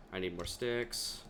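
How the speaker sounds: usual speaking voice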